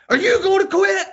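loud, very high mimicked outrage; mad kid. Son throwing dad's question back mid-plank, mad. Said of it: "he's so mad".

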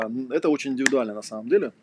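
ordinary talk, nothing marked

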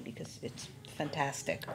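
hushed whispered aside; whispering. Real, not acted. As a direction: whisper it like a secret tip. Aside recommending the restaurant.

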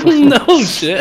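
laughing out loud through the words. Real, not acted. Cohost laughing at the dinner story: loud laugh. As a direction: burst out laughing as you say it.

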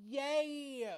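squealing with delight; excited gasp and cheer. Real, not acted. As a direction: gasp then a bright high yay. Excited gasp at favorite question.

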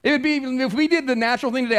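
ordinary delivery

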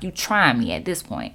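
low, sassy warning; low and loaded with sass; attitude. Retold or imitated. Clerk warning rude customer, low sassy attitude. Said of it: "that's when the attitude really came".

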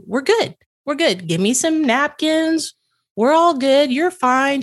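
lifted, soothing reassurance; reassuring. Retold or imitated. To the server about to cry, soothing and reassuring. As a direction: settle her down gently before she cries.

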